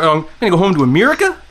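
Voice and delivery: fast, mock Kiwi accent where every line rises like a question; Kiwi accent with a question lift. Shown as a demonstration. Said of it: "And everything sounds like a question".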